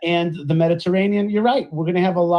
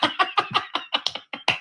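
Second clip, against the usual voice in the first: breathy laugh; 11 semitones higher, 6 dB quieter than usual. Real, not acted. Laugh at the clip.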